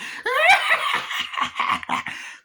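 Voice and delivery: high breathy evil cackle; doll cackle. Retold or imitated. Chucky gloats after the kill with a high breathless cackle. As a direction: let out a shrill whispery doll laugh. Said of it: "and Chucky's like".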